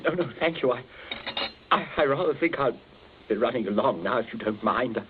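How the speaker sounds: shaken excuse. Acted. Shaken captain wants to leave.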